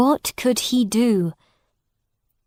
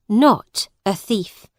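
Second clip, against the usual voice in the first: ordinary delivery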